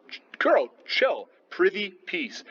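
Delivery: mock Macbeth waving his wife down; telling her to chill. Teacher voicing Macbeth calming Lady Macbeth, high mock pleading.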